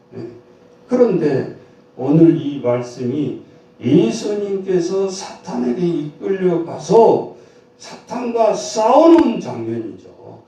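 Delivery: ordinary talk, nothing marked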